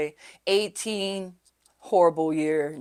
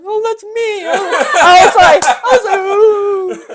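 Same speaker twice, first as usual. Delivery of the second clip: "Oh, that's me" squealed much higher through real laughter; laughing realization; 12 semitones higher, 13 dB louder, slower than usual. Real, not acted. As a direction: laugh it out, high and louder, giddy with recognition. Realization at the church retold.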